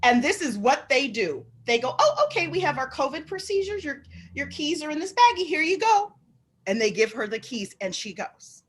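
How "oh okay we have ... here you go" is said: high, sing-song customer-service impression; obliging staff. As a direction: imitate staff being extra accommodating. Voicing obliging staff to favored customer.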